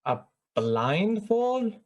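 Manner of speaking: high, drawn-out disbelieving question; incredulous. Acted. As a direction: balk at an absurd demand. Character balks at the blindfold demand.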